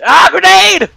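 loud sudden alarm; panicked yell. Real, not acted. Grenade panic.

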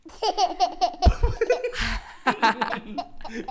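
high, loud helpless laugh; real laughing fit; high burst of laughter. Real, not acted. Laughs at baby's face.

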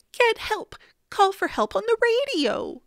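high urgent appeal. A narrator's reading. Boy begs policeman to radio for help, urgent. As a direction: push them to act, pitch jumping up.

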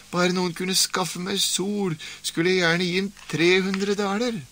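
high, lightly put-on old woman; a puzzled old woman explaining herself; old woman's voice. Old woman tells the stranger about the sun, puzzled and high.

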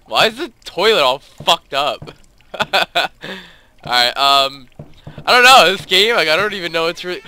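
ordinary talk

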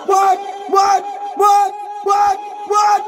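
high, punched repeated shouts; rapper's "what" ad-lib. Pretend. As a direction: fire them out like a hype man. Rapper ad-libs on the mic.

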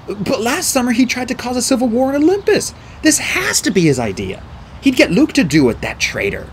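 high, insistent protest; heated arguing. A narrator's reading. Boy blames Kronos for the poison, heated arguing.

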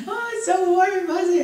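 high, sugary mock gushing; mock sweet believer. Preacher mocking churchgoers who want comfort, sugary high mock. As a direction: parody a shallow believer's delight. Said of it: "Everybody wants a warm and fuzzy Jesus".

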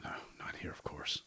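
whispered aside. Acted. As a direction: whisper it to yourself.

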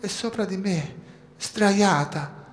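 put-on trapped girl telling the rescuer about her sister; small child's voice. A narrator's reading.

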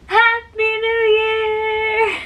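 high, slow, sung-out cheer; new year countdown crowd. Pretend. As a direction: belt it out like midnight. Countdown ends, calls out greeting.

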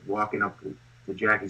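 ordinary talk, nothing marked

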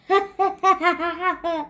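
a high mocking laugh; gleeful laugh. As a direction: laugh at your own joke. Petey laughing at his own joke.

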